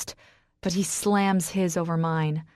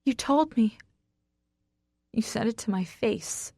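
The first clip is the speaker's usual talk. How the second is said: hurt croak; 4 semitones higher than usual. A narrator's reading. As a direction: croak out the accusation. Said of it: "I croak".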